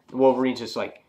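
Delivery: ordinary delivery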